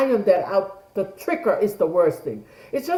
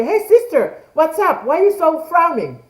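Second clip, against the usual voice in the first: bright, higher, friendly "hey, what's up" check-in; cheery greeting to sister; 8 semitones higher, 4 dB louder than usual. Pretend. Speaker greeting her frowning sister, upbeat and higher. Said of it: "or I'll say".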